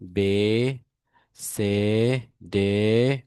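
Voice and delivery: বে, সে, ডে said the German way, each letter spaced; German alphabet letters. Shown as a demonstration. Teacher reciting German letters to the class, slow and clear. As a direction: spell out বে, সে, ডে slowly like a German alphabet drill. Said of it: "আমরা যেটাকে ইংলিশে এ বলি".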